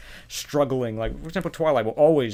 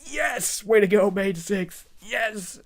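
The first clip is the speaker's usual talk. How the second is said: cheering; 8 semitones higher, slower than usual. Real, not acted. Cheering the heroes on.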